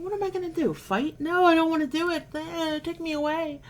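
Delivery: high, hammed-up whine of reluctance; mock whiny protest. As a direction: play it like a dramatic kid refusing, then giving in.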